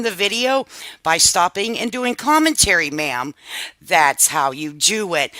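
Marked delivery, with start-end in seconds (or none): none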